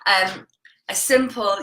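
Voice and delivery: usual speaking voice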